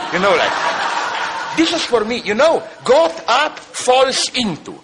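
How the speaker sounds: real laughter breaking through the words, pitch jumping up; laughing at own joke. Real, not acted. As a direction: chuckle through the punchline and its point. Laughing at his own punchline.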